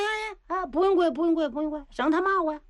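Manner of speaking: high, hurried backpedaling voice; a scheming old official hastily giving in; flustered minister. Acted. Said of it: "秦桧儿一看".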